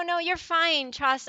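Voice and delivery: usual speaking voice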